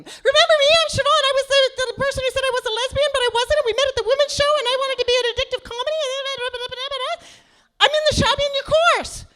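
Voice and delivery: very high, loud, rapid gush; gushing fan. Retold or imitated. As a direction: babble it all out like a nervous superfan. Gushing fan reintroducing herself.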